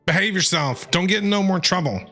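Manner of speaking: ordinary talk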